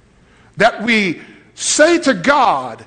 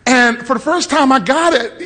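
ordinary talk, nothing marked

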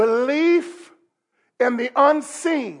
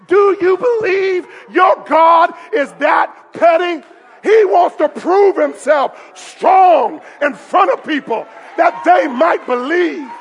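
slow, high, forceful preacher shout; full-force pulpit cry; impassioned preaching; 5 semitones higher, 6 dB louder, slower than usual. Real, not acted. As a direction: drive each phrase at the congregation.

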